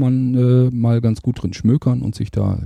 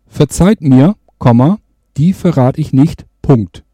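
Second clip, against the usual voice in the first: dictating; 5 dB louder than usual. Shown as a demonstration. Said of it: "und sprech dann den Rest ein".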